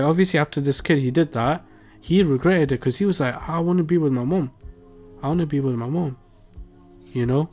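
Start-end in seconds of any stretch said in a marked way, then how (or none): none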